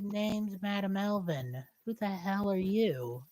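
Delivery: lightly voiced elf, soft and unhurried; wary elf character. Elf sizing up her unexpected rescuer, lightly voiced and quiet. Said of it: "they look up at you and say".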